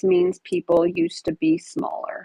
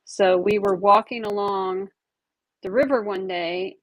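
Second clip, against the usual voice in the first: usual speaking voice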